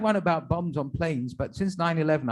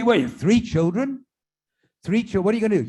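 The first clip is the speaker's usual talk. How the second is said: surprised rabbi; 6 semitones higher, 4 dB louder than usual. Acted.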